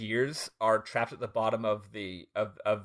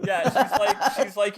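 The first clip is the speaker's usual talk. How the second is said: higher, louder words through laughter; laughing; 8 semitones higher, 6 dB louder than usual. Real, not acted. Retelling after the Soylent joke, laughing. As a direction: talk while cracking up.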